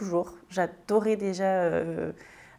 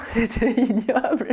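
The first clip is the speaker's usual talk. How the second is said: slow, laughing through the words; amused disgust through a laugh; 4 semitones higher, 5 dB louder, slower than usual. Real, not acted. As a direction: laugh out the verdict, slower. Laughing at her childhood perfumes.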